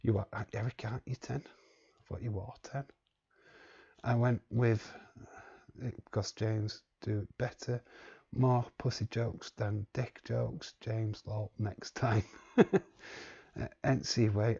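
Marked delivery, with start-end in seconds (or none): none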